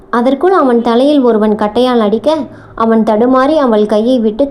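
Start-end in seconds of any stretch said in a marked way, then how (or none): none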